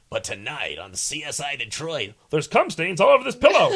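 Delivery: loud, high, dramatic crime-show teaser; hammed-up TV promo voice. Mocking a network promo for a crime show, loud and high. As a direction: announce it like a sleazy TV promo. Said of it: "Good Morning America's like".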